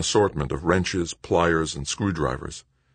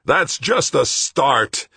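high, loud, drawn-out shout; 10 semitones higher, 5 dB louder, much slower than usual. A narrator's reading. Greg over the blaring loudspeaker warning, shouting. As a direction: shout it out, stretching the words. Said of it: "Greg shouted".

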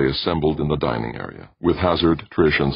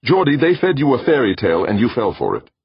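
loud, high-pitched scolding; angry outburst; 9 semitones higher, 6 dB louder than usual. A narrator's reading. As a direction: burst out at your brother, voice raised. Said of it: "Brandon burst".

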